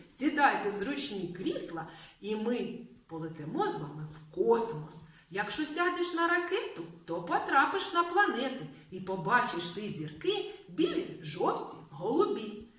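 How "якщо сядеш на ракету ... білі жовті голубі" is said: 1 rhyme recital. Acted.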